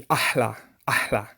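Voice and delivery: "ahla" with a breathed H in the middle; proper Arabic pronunciation. Shown as a demonstration. Teacher correcting the slang word, breathed H. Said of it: "It actually should be".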